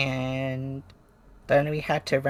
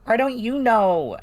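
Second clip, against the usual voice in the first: higher, louder, pressing for an answer; one twin grilling the other, raised and insistent; demanding question; 6 semitones higher, 5 dB louder than usual. Acted. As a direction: push them hard for the truth. Players argue in character.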